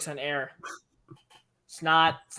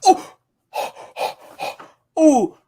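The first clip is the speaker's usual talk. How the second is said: surprised gasp; 8 semitones higher, 7 dB louder than usual. Real, not acted. Reacting to highlight play.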